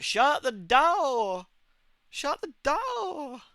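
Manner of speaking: imitated Southern waitress, drawn-out high vowels; thick Southern accent. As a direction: stretch it out in an exaggerated Southern twang. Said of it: "really, really thick southern accent".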